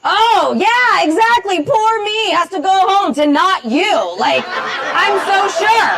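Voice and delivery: high, drawn-out sarcasm; sarcastic. Real, not acted. Comic hitting back at heckler who has a date, sarcastic. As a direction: stretch out the mock self-pity.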